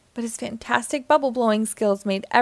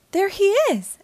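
high, loud excited discovery; Sandy excitedly exclaiming; 11 semitones higher, 5 dB louder than usual. Acted. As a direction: burst out, thrilled to spot him. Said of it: "exclaimed Sandy".

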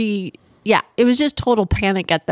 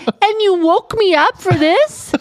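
slow, higher-pitched disbelief; mock indignation; 7 semitones higher than usual. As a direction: draw out an incredulous complaint. Woken parent retells her indignation.

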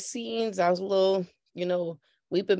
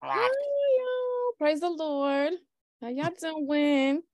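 churchy greeting; 7 semitones higher, much slower than usual. Acted. Guest greets in churchy style.

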